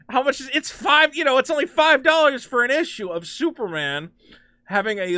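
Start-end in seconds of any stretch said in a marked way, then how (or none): none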